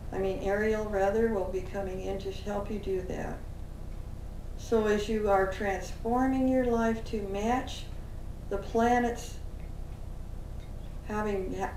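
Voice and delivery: usual speaking voice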